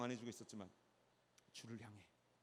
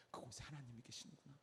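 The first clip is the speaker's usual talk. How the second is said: ordinary delivery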